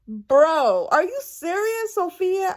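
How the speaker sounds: high "are you serious, Sophia?"; dismayed and disbelieving; shocked scold, pitched high; incredulous scolding. Retold or imitated. Reacting to Sophia's mistake.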